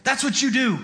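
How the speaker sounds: high, impassioned insistence; indignant rebuke. Acted. Community to negligent father, indignant scolding.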